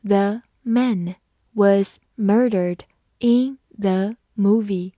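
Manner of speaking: each word set apart by a pause; slow model reading, word by word. Shown as a demonstration. Teacher modelling a sentence for learners, slowly word by word. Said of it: "好，慢慢来读".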